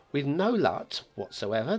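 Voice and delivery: ordinary delivery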